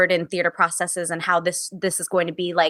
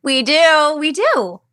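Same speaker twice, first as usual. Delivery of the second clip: louder, higher, eager agreement; emphatic agreement; 7 semitones higher, 4 dB louder, slower than usual. Real, not acted. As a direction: jump in to agree with feeling. Emphatic agreement, gasp before.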